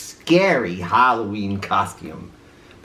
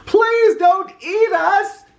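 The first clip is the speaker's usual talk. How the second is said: very high, slow terrified plea; pleading in fright; 4 dB louder, slower than usual. A narrator's reading. Dogs begging the monster, high and terrified. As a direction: beg for your life. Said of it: "cried the dogs".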